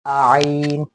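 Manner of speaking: loud आइन with an extra आ in front; wrong leading vowel. Shown as a demonstration. Teacher showing the error, loud आइन with an added vowel. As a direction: push a stray आ before आइन. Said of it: "ये नहीं करना".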